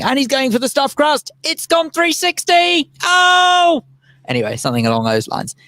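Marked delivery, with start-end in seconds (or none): 0.0-3.8 much higher, slow excited commentary; Murray Walker. Retold or imitated. Murray Walker commentating Damon eating pizza, high and excited. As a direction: call the pizza eating like a race, rising to a cry. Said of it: "you just sort of hear him saying".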